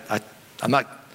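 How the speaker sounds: usual speaking voice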